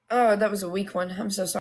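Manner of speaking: usual speaking voice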